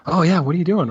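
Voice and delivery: curious coworker. Retold or imitated. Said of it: "it's like".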